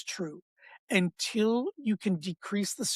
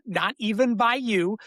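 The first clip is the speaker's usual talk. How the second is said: ordinary delivery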